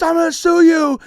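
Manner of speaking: high, loud furious threat; hammed-up loud threat; angry threat. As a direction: yell the threat at the photographer. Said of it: "And he goes".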